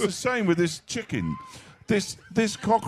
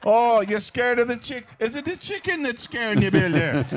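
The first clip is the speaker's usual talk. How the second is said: Irish builder teasing; 4 semitones higher than usual. Retold or imitated. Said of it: "Like this".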